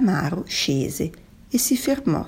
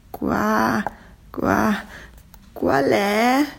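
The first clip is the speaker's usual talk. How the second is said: high, halting stammer; nervous stammer; 5 semitones higher, much slower than usual. A narrator's reading. Squid facing the approaching tuna, stammering nervously.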